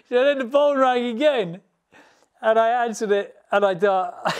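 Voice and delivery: can barely get it out for laughing. Real, not acted. Laughing through the punchline build.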